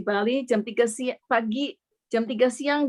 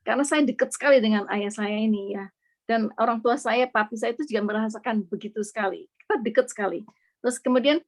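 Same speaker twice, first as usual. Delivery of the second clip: ordinary delivery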